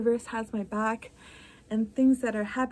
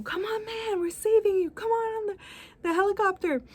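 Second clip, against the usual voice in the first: much higher rescuer calling down from a helicopter; 9 semitones higher, faster than usual. Acted. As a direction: call up high and urgent. Helicopter rescuer voiced in story.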